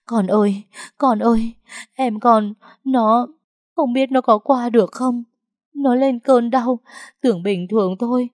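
high, wailing cry of a frightened mother; sobbing mother. Acted. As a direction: cry it out, voice climbing and breaking. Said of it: "òa lên khóc nức nở". (giọng người mẹ khóc nức nở, cao và nghẹn)

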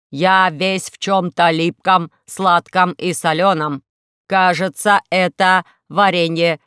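slow, loud, measured robot voice; heavy, deliberate robot lament; glum robot. Acted. Robot complains to Alisa about being sticky, slow and heavy.

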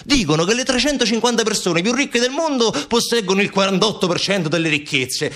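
high, impassioned outburst; indignant Montalbano. Acted. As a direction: plead urgently against the world's injustice.

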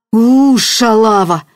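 loud, drawn-out curse in a frightful voice; snarled curse, long and loud; terrible voice. A narrator's reading. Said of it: "вдруг страшным голосом сказал он".

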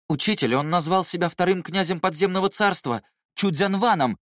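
put-on high, worried young disciple; anxious young man. A narrator's reading. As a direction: voice him alarmed and tense. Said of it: "встревоженно произнёс Гуюн".

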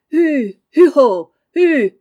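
long, loud, high calls to a horse; carter's shout. Acted. Laurent urging on the cart horse, shouting. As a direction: drive the horse on with drawn-out calls.

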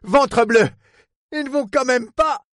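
loud oath, then high disbelief; aghast exclamation. Acted. Port man watches the boat approach, aghast. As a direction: exclaim as if disaster is coming.

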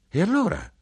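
high sharp exclamation as the mother; mother cries out, rising high; exclaimed. Acted. Mother to son, exclaiming high. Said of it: "esclamò mia madre".

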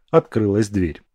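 plain reading voice

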